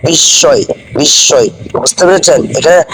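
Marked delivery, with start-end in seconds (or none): 0.1-1.5 বিস্ময় with silent ম and doubled s, "bis-soy"; correct spoken pronunciation. Shown as a demonstration. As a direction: say বিস্ময় as "bissoy", no m. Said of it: "উচ্চারণ করলে হবে না".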